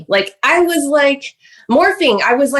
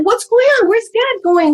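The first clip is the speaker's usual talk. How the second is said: much higher, urgent questions; acted childlike alarm; alarmed child retold; 12 semitones higher than usual. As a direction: imitate a panicked kid asking after a parent. Retells child asking where dad goes.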